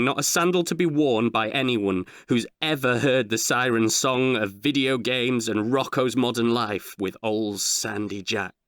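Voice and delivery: plain reading voice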